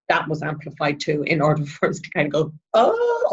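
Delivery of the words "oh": high drawn-out oh; sudden mock realisation, pitched high; acted realisation.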